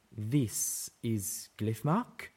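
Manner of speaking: slow, stunned question; disbelief. A narrator's reading. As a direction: ask it as if you can't believe your eyes. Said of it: "Zenith asked in a tone of disbelief".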